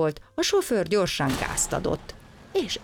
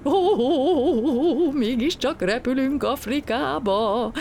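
very high, loud, slow rejoicing; jubilant cry; 11 semitones higher, 5 dB louder, slower than usual. A narrator's reading. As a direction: cheer as the plane moves. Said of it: "újjongott Gombóc Artúr". (ujjongó, magas, hangos kiáltás)

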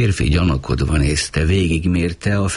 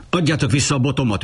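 higher and fast, a hurried yell; bellowing; 11 semitones higher, much faster than usual. A narrator's reading. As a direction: demand the stick back with a roar. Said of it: "üvöltötte".

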